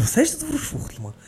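very high-pitched mock outrage; squeaky retold reproach; incredulous brother. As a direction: imitate a baffled brother scolding. Acts out brother confronting him.

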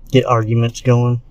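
normal talking voice

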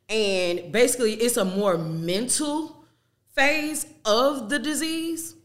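usual speaking voice

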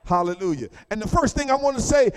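building sermon cadence with drawn-out key words; preaching. Acted. Preacher to the congregation, full sermon cadence.

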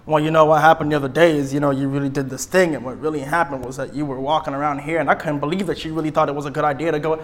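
low, quiet rambling run-on; mock long-winded complaint; beating around the bush. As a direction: ramble on without reaching the point. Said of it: "Stop beating around the bush".

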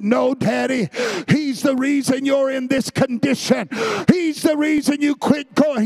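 high, loud angry shout; sons shouting. Retold or imitated. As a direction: yell back at your father. Sons shouting at dying father.

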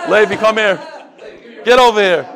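loud summons across the room; calling out. Real, not acted. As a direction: call him up firmly. Calling Levi up from the crowd.

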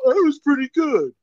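high, loud mock defender; mocking co-host imitation. Host quoting co-host's defense of a film, high loud mockery. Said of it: "You're all".

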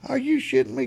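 high-pitched incredulous question; mock disbelief. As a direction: imitate a coach who can't believe it. Coach's retold disbelief.

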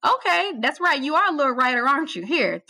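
mimicking the boss's teasing tone; imitating the boss. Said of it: "he was like".

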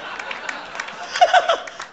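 breathless high cackle; high unvoiced giggle; high wheezing laugh. Real, not acted. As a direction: burst into a squeaky laugh. Cackle after the impression.

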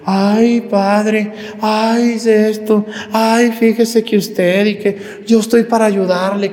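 a hint of a sweet-talking courtier; mock flatterer. Priest mocking flatterers who court the powerful, lightly fawning. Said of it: "andar con los poderosos allí de".

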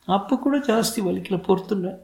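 put-on high-pitched little boy voice; small boy. A narrator's reading. Son telling his father it doesn't hurt, small boy voice. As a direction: answer as a child, pitched right up.

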